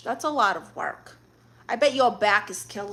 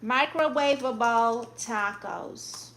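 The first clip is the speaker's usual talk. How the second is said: usual speaking voice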